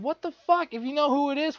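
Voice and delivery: ordinary delivery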